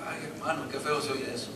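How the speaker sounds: a hint of a squeamish churchgoer; imitating a listener.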